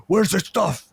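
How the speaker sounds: high-pitched mock aggressive cop; confrontational demand. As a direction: demand it like a pushy little cop. Little cop confronts him in retelling.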